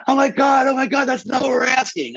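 high-pitched, squawking group protest; mock shocked outcry. Judges protesting the contestant's answer, mock shocked outcry. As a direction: imitate scandalized judges crying out.

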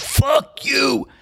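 very high-pitched mock shout; retold shout, voice shooting way up. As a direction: stretch it into a high, imitated shout. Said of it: "he's yelling it louder and louder".

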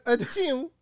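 squeaky high cute sneeze; a tiny high-pitched anime sneeze. Real, not acted. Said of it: "why'd you sound like an anime bitch".

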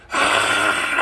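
breathy, voiceless roar; hammed-up whispered crowd-roar scream; mock war cry. Streamer going to war, mock breathy battle scream.

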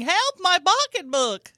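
high, loud, drawn-out cry for help; mock scream. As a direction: scream it like a hammed-up robbery victim. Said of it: "I fall to the ground screaming".

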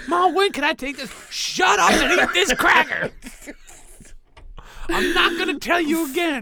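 put-on high, loud squeaky kid and mom; bickering family voices. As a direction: play the squabbling family. Kid then mother arguing.

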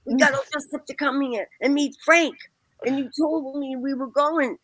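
high, pleading protest, voice pitched well up; indignant protest. Retold or imitated. Teen girl protesting to the doorman, high and pleading. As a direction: beg him to let you in, all worked up.

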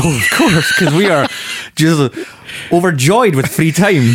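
drip it with sarcasm; sarcastic. Real, not acted. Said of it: "you're so bitter about it".

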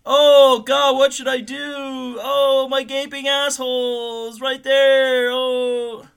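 high, loud, drawn-out whiny wail; hammed-up cry for attention, moaning long and high; mock pleading lure. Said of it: "and being, like, looking around".

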